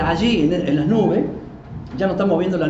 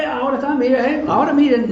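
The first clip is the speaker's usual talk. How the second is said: higher, louder mock call to look; mock pastor's alert; 7 semitones higher, 3 dB louder than usual. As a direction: lightly act a pastor alerting everyone. Voicing a pastor alerting the church.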